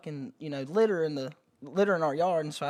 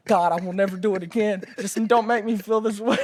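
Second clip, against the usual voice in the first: pleading, pitched up, loud and groaning; begging, sick; 5 semitones higher, 5 dB louder than usual. Retold or imitated. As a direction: beg like you're sick on the floor. Retold pleading while sick from dip.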